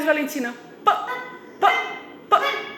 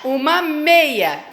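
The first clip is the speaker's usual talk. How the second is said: uma meia said slowly; slow sounding out; much slower than usual. Shown as a demonstration. Teacher modelling for the child. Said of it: "vamos lá, devagarinho".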